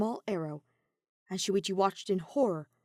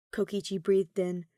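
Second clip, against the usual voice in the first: ordinary delivery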